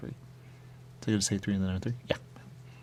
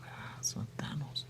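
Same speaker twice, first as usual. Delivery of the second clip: higher unvoiced whisper; 6 semitones higher, 11 dB quieter than usual. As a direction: breathe it out, disappointed. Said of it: "he goes".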